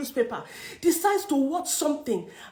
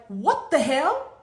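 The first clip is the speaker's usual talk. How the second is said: drawn-out what the hell; slowly, stunned and fed up; slow disbelief; slower than usual. Retold or imitated. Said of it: "I'm like".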